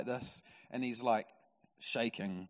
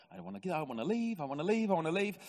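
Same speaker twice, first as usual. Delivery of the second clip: higher, quick repeated mock whimper; comic panicky sing-song pleading; desperate to leave; 5 semitones higher, faster than usual. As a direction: act out someone pleading to escape, jostled up and down. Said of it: "bouncing like this on top".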